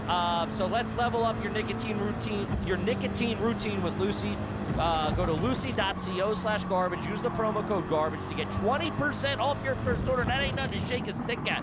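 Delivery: upbeat sponsor read. Acted. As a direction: read it like a podcast ad. Podcast host ad read.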